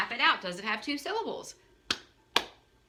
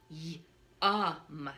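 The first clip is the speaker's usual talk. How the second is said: savoring yum; 3 semitones lower than usual. Acted. Savoring a tasty word.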